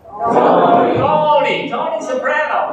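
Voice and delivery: high, loud hammed-up announcing of the name; loud reveal. Shown as a demonstration. Telling the audience the main character, loud mock announcer. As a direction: belt the name out like a show intro. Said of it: "was named what".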